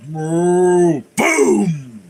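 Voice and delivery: loud playful moo then boom. Acted. Cow sound and explosion.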